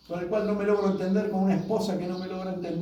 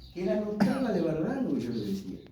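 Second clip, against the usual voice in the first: normal talking voice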